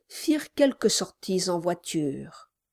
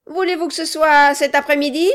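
loud, higher dwarf voice; a loud, pushy little man; gruff gravelly dwarf voice; 8 semitones higher, 8 dB louder, faster than usual. Acted. Quilp speaks.

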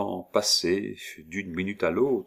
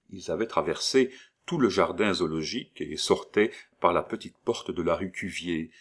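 ordinary delivery, nothing marked

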